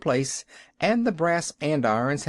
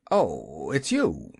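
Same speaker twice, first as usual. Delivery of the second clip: ungracious; 7 semitones lower, slower than usual. A narrator's reading. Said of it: "not very graciously".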